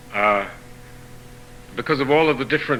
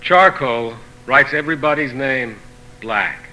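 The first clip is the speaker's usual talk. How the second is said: poetry reading; 3 dB quieter, slower than usual. Acted. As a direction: read each saying in separate phrases, pausing between them. Reading of proverbs continues.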